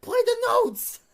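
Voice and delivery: high, incredulous rant building to a shout; exasperated yelling. Retold or imitated. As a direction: climb into a high-pitched yell. Retold conductor berating the drummer.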